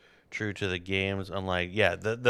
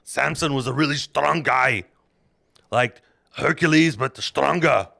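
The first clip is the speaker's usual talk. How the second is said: loud, high comic Bible retelling; boisterous mock-epic narration; hammed-up storytelling; 4 semitones higher, 8 dB louder than usual. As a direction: tell it like a rowdy campfire tale.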